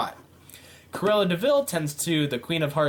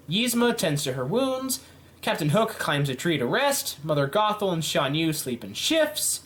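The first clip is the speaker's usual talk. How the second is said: ordinary delivery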